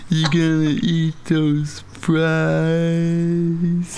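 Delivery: very slow, drawn-out sloth voice; super slow sleepy sloth. Acted. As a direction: drag every word out like a sloth. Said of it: "We definitely need a bit where you talk like a sloth".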